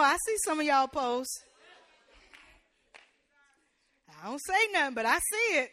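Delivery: loud, rising sermon call-out; hammering the congregation with it; preaching at full force. Acted. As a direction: preach it out to the whole room. Preaching climax.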